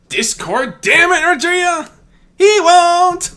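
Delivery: screamed high and loud, furious; screaming. Acted. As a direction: yell it across the battlefield. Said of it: "she screamed out".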